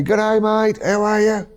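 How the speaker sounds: high, loud mock greeting; hammed-up impression of a friendly local; imitating Iraqi man.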